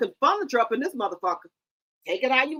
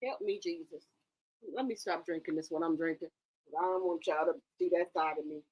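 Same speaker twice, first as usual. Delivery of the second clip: quiet aside; 9 semitones lower, 11 dB quieter than usual. Real, not acted. Aside while drinking.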